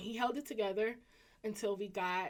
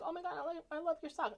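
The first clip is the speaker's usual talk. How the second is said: gushing; 8 semitones higher, faster than usual. Retold or imitated. Staff gush over her socks.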